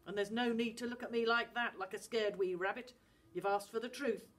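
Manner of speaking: weary father steeling himself; gruff Scottish dad. Acted. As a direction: brace the boy for the truth. Dad prepares to confess.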